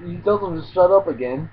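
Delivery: normal talking voice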